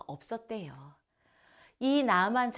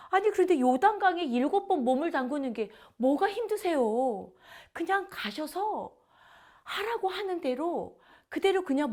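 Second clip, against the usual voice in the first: a higher, lightly voiced servant begging; pleading servant; 6 semitones higher than usual. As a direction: coax the general, higher and urgent. (높은 목소리로 간청하는 종)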